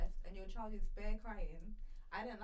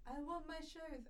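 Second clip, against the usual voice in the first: put-on whiny child; 8 semitones higher, 4 dB quieter, slower than usual.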